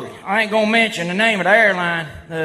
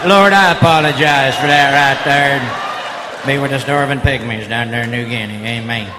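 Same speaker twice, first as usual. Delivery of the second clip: low, preachy mock prayer to the Lord; hammed-up solemn prayer after a dirty joke; 7 semitones lower than usual. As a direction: drop low and pray like a preacher, tongue in cheek.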